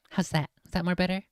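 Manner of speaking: ordinary delivery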